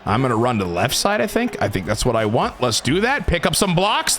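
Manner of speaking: ordinary delivery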